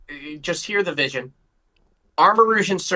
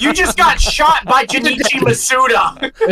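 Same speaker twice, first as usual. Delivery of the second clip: dramatic announcement; 8 semitones higher, 6 dB louder, slower than usual. Acted. Dramatic announcement of mock death.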